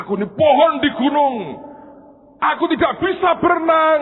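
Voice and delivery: high, loud, fast protest; panicked shout. Acted. Mountain tree made into a boat, shouting in protest. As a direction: shout that you cannot swim. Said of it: "Dan dia berkata".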